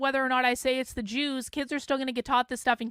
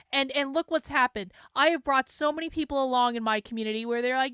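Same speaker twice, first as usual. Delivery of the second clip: ordinary talk, nothing marked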